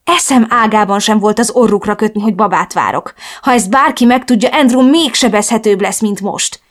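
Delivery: usual speaking voice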